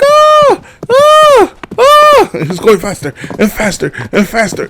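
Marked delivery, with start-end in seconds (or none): 0.0-2.3 high mock shrieks of panic; cousin's scream. Cousin on the speeding horse freaking out, high mock screams. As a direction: shriek like a scared kid on a runaway horse. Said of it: "And she's like".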